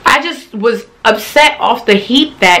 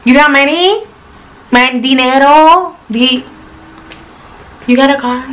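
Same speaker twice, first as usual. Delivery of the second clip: mock gold-digger questions; 5 semitones higher than usual. Mocking what she asks men.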